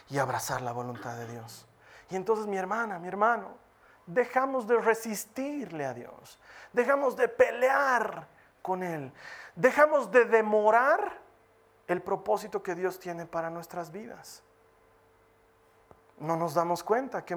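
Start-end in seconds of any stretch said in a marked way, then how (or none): 4.1-11.2 slow, drawn-out preaching; emphatic preaching. Acted. Preacher urging brothers and sisters, slow and emphatic. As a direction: lean on each repeated phrase slowly.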